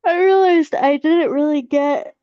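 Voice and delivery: talking through a yawn; words stretched by a big yawn. Real, not acted. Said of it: "y'all are contagious".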